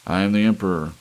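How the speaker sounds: loud, proud royal cry; booming declaration of an emperor; cried out. Acted. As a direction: proclaim yourself emperor. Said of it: "cried Harrison".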